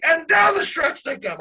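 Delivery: high, loud race-call imitation; hammed-up announcer hitting the big moment; excited announcer call. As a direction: belt it like a broadcaster calling the finish. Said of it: "So Paul gets excited".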